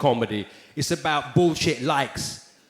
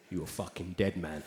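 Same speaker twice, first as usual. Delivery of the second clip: much lower and softer menacing thought; muttered inner threat; 10 semitones lower, 14 dB quieter than usual. Retold or imitated. Thinking as the star runs off, quiet menace. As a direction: growl it under your breath. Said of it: "I thought to myself".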